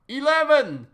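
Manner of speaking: shouted announcement. Acted. Numbered absurd decrees, crier's bellow.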